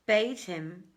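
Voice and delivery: plain reading voice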